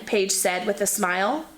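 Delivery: plain reading voice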